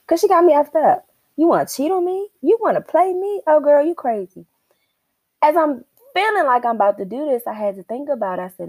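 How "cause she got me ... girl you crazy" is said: high, loud indignant rant; hammed-up furious girlfriend rant; mock outraged. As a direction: raise your pitch and vent at her. Venting rage at cheating partner.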